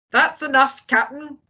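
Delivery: high, cheerful call; breezy high-pitched hail; cheery shout. A narrator's reading. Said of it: "shouted Long John cheerily".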